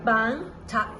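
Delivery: ordinary delivery, nothing marked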